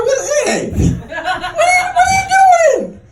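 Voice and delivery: high, squealed objection; protesting woman. Retold or imitated. Woman protests being slapped.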